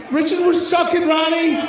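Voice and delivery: ordinary talk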